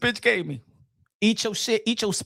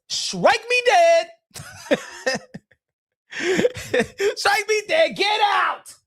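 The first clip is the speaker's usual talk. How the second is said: mock wailing plea; mock despair; 7 semitones higher, much slower than usual. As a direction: beg to be struck down, hammed-up. Pleading to God in horror.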